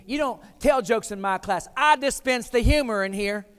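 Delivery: loud stern rebuke, slowing on the second line; angry teacher. Retold or imitated. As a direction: bark it out like an offended teacher. Angry teacher scolding student.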